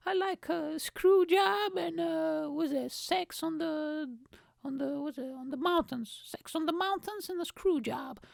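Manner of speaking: put-on high, ditzy girl fumbling drink names. Girl telling the guy her favorite cocktails, high ditzy voice. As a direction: drag out screw like a tipsy girl.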